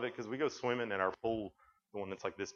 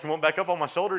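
loud teasing offer; 6 semitones higher, 9 dB louder, faster than usual. Retold or imitated. Teasing son in pool.

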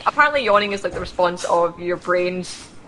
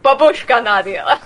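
mock babushka; 3 semitones higher, 4 dB louder, slower than usual. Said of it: "the rest of me are just like".

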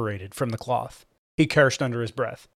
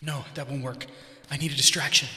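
inner thoughts; 6 dB quieter than usual. Acted.

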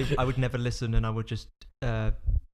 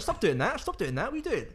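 retold protest; 8 semitones higher than usual. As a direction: act out telling a mate off, pitched up. Retells himself telling guy off.

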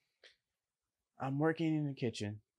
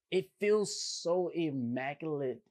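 ordinary talk

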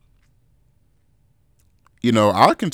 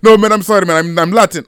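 high, loud mock Latin accent; bad Latin accent; 10 semitones higher, 8 dB louder than usual. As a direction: imitate a man apologising in a terrible Latin accent. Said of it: "my Latin accent's terrible".

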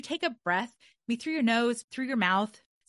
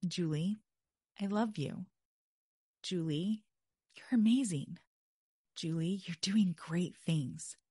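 tender self-talk; 2 semitones lower, 4 dB quieter than usual. Shown as a demonstration. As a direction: speak gently to yourself like to someone you love. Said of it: "it's so simple".

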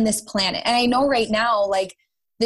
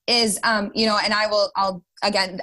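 usual speaking voice

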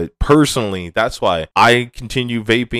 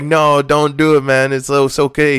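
higher and louder, pleading on the edge of tears; retold tearful plea, higher and louder; near tears; 6 semitones higher, 4 dB louder than usual. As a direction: beg him to stop, voice about to crack. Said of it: "I almost started crying".

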